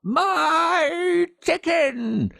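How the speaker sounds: put-on high, drawn-out, wailing old woman's cry; old neighbour wailing at the boy, high and slow; screaming old woman. A narrator's reading. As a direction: stretch MY out in a shrill old woman's wail. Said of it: "she screamed".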